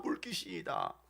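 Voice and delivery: low, hushed throwaway repeat; quiet repeat. Acted. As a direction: drop it to a soft aside. Same cry repeated in low aside. (낮고 작게 툭 되뇌듯)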